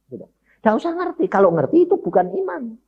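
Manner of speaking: ordinary delivery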